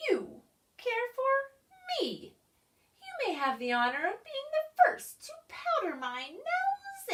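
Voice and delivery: a hint of a commanding creature; lightly voiced bossy creature. A narrator's reading. Creature bosses the pig, lightly voiced.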